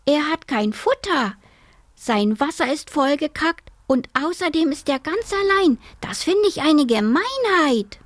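put-on high-pitched child's outrage; indignant girl. A narrator's reading. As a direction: protest like a girl appalled at the grown-ups. Girl accuses the grown-ups.

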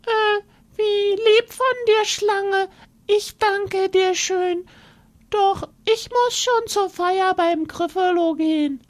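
high squeaky mouse, stammering; nervous little mouse. Acted. Mouse politely refusing the fox, high and hesitant.

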